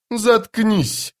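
plain reading voice

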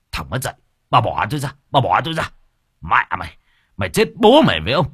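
angry father. Acted. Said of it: "father struggling free".

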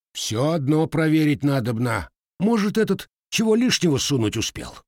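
stubborn insistence, pitch rising high on the accusation. A narrator's reading. Husband insists on searching the bundle, stubborn and suspicious. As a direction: dig in and accuse the lad. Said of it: "упрямо ответил мужик".

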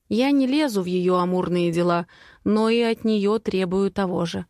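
ordinary delivery, nothing marked

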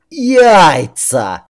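loud furious yell. A narrator's reading. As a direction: bellow the word out. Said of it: "опять заорал он".

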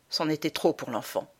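usual speaking voice

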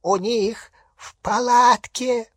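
high, slow, strained croak; choked werewolf rasp; hoarse wheeze. A narrator's reading. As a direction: force the words out with effort. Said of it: "прохрипел Фенрир".

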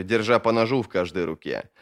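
ordinary delivery

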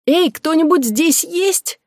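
put-on high, slow squeaky little girl; squeaky child voice. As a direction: call out in a tiny childish squeak. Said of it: "голос выходит писклявым, детским".

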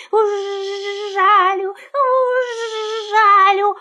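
high, slow, with long buzzing zh; buzzing bee voice. A narrator's reading. Bee chases the fleeing puppy, high buzzing threat. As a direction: threaten like a bee, stretching the buzz. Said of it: "летит и жужжит".

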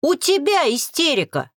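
high, angry comeback; barked retort. A narrator's reading. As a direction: bark it back indignantly. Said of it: "снова рявкнула я".

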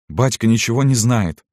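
plain reading voice

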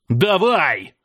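drawn-up cry, throwing yourself at the enemy; wild battle cry. A narrator's reading. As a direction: yell it as you charge in. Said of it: "С диким воплем".

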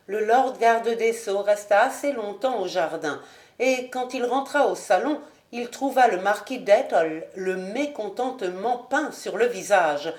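ordinary delivery, nothing marked

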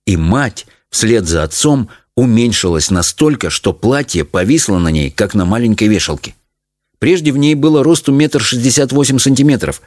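ordinary delivery

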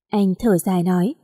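ordinary delivery

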